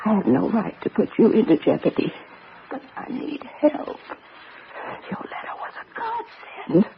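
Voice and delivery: soft, urgent whisper; desperate whisper. Acted. Invalid wife secretly begging Becky for help, desperate whisper. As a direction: confide it as if every word is dangerous. Secret plea while husband away.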